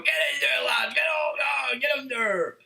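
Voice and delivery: bellowing from the terraces, pitch jumping up; hammed-up cheering yell at a player; mock football fan shout. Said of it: "they're gonna be the ones going".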